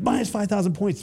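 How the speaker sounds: high-pitched imitated official call; instructor announcing a penalty.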